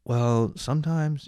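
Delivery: high, slow, hesitant little voice; timid self. Retold or imitated. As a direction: shrink into yourself, unsure of every word. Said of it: "you know, I'm so timid".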